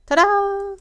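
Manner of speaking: high, loud showy reveal; triumphant ta-da. Acted. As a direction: announce it proudly.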